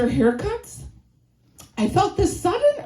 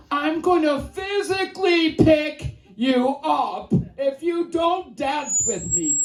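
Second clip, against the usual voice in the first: shouted pickup line; 5 semitones higher than usual. Retold or imitated. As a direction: bellow it across the bar. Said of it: "my pickup line, which was".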